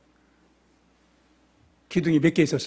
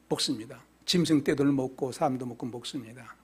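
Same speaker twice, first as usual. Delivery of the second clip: normal talking voice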